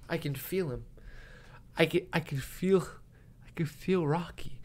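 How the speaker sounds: soft, halting mock awe; imitated hushed reverence. Wearing Rocky's mouth guard, imitated hushed reverence. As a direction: say it softly, as if moved.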